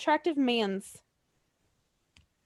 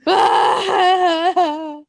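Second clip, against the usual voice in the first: howling laugh; 5 semitones higher, 7 dB louder than usual. Real, not acted. Laughing at crying joke.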